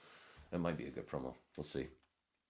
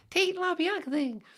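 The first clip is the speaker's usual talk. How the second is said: very high, loud squeaky take; silly high voice; 7 dB louder than usual. Acted. Host mocking a passerby's answer, silly squeal.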